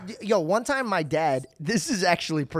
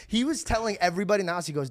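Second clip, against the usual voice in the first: ordinary talk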